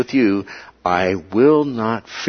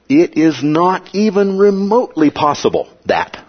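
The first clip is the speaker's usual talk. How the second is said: higher pitch, firm stress on "not even remotely possible"; emphatic statement; 7 semitones higher than usual. Shown as a demonstration. Teacher showing how English stresses a denial, emphatic "not even remotely". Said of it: "That kind of emphatic statement".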